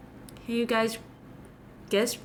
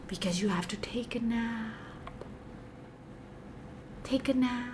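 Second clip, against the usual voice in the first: whispering; 9 dB quieter than usual. Pretend. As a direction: lull them to sleep. Hypnotic whisper.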